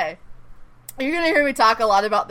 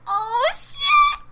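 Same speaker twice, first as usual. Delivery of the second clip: excited shock. Retold or imitated. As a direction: squeal it like a thrilled viewer. Acting out shocked viewer reaction.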